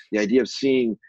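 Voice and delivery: usual speaking voice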